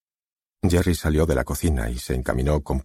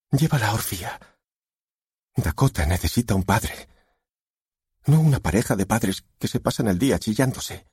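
pleading wife; 7 semitones higher than usual. A narrator's reading.

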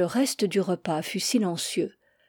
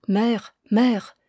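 calling softly; 3 dB louder than usual. Acted. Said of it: "J'appelai doucement ma mère".

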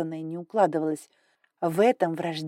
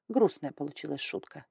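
ordinary delivery

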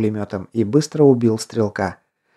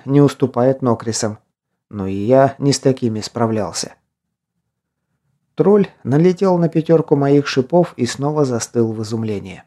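usual speaking voice

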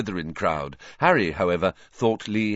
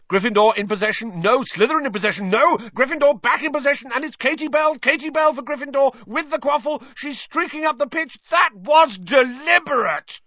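high, loud fast commentary; excited play-by-play; 4 dB louder than usual. A narrator's reading. Lee Jordan commentating the match, excited play-by-play. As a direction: call the action as it happens.

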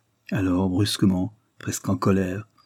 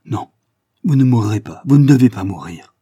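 loud pleading cry, louder; 2 semitones higher, 9 dB louder than usual. A narrator's reading. Vera pleads with the wounded Mélinov, loud cry. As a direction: Vera cries out to the dying man, pleading and loud. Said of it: "en s'écriant".

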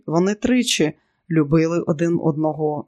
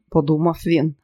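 ordinary delivery

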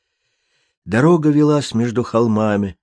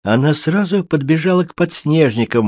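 ordinary delivery

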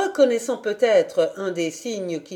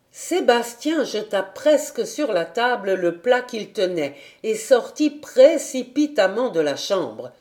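plain reading voice

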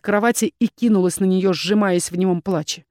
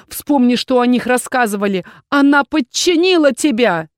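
higher and louder, slowing at the end; desperate accusation; 5 semitones higher, 6 dB louder than usual. A narrator's reading. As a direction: cry it out to convince him. Desperate woman accuses her rival.

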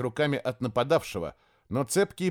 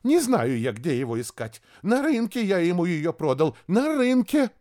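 panicked shouting; 6 semitones higher, 4 dB louder than usual. Acted. Panicked envoy continues shouting.

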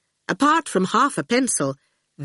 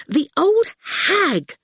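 angry; 10 semitones higher, slower than usual. Acted. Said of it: "Fifi said angrily".

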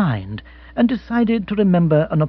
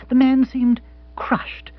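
plain reading voice

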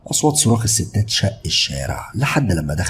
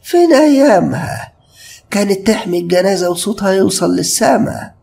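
put-on slow, higher old woman's wail of complaint; old woman lamenting; 12 semitones higher, 4 dB louder, slower than usual. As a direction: mourn the old days of loud funerals, slowly. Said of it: "اتكلمت بصوت يا دوب مسموع". (ست عجوزة بتندب ببطء)

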